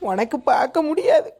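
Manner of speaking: hammed-up sobbing lament; fake crying. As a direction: put on a whiny fake cry. Wife fakes grief at bus stand.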